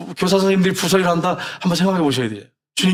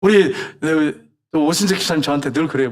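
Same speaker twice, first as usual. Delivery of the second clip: ordinary talk, nothing marked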